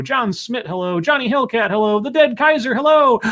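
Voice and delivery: comic roll call ratcheting upward; rising pitch. Pretend. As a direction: push each hello up until breath runs out. Roll call climbing until he gasps.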